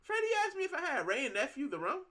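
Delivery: can you believe he asked me that; incredulous. Real, not acted. Telling the stream about Freddie's question, incredulous.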